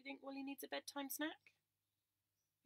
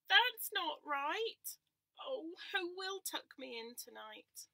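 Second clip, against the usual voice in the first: higher, slow and louder complaint; whiny lamb; 7 semitones higher, 10 dB louder, much slower than usual. Acted. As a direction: drag it out like an upset lamb. Lamb complains, tucked too tight.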